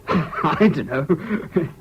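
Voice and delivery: amused, drawn-out words through a laugh; slow, chuckling admission. Acted. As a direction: laugh off your own ignorance. Happy, unsure about wedding customs.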